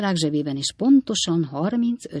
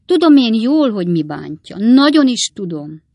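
high, emphatic loud sympathy; knowing commiseration; 5 semitones higher, 9 dB louder than usual. A narrator's reading. Rogersné assures Redlné she understands, emphatically pitying. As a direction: insist that you understand her woe.

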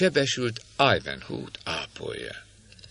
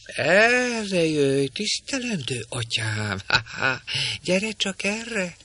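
high, shrill-voiced crone scolding; a nasty old woman scolding the girl in a screech; screeching old hag; 9 semitones higher, slower than usual. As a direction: cackle it out like a spiteful old witch. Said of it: "mondta az öregasszony".

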